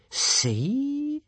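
long word sliding up high; hissing creature. A narrator's reading. Monster showing Hiccup its deadly tail, rising creature hiss. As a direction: stretch it upward with menace. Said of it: "hissed the creature".

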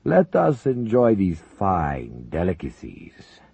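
slow, heavy voice of a menacing general secretary; Soviet leader. Acted. Zelinkov to his uneasy comrades, slow heavy menace. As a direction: savor each word like a man in power.

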